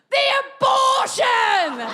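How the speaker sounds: loud, high, long-drawn holler; triumphant bellow, very slow; gleeful shout. Retold or imitated. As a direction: yell it out to the whole crowd, stretching every word. Retold disastrous punchline yelled at crowd.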